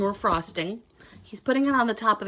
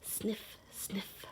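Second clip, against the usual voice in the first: soft whispered sound word; 15 dB quieter than usual. Acted. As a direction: breathe it out like a secret sniff. Whispered sniffing sound in story.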